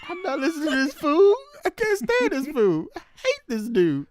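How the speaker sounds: high, loud, hammed-up complaint; playfully whiny, worked-up griping; mock exasperated rant. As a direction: voice a fed-up cop in comic outrage. Voicing an uncaring cop's rant.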